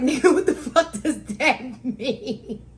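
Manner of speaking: slow, loud, high disbelief through laughter; baffled, laughing. Retold or imitated. Daughter baffled by father's explanation, laughing disbelief. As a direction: drag it out, cracking up. Acts out her baffled reaction.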